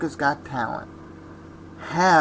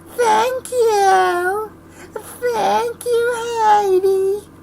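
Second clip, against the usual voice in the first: high squeaky sobbing thank-you; mock crying thanks; 8 dB louder, much slower than usual. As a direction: wail it like an overacting contestant. Mocking tearful talent-show contestant.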